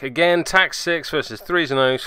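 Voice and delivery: ordinary talk, nothing marked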